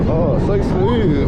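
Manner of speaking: excited. Real, not acted. Ride reaction on coaster.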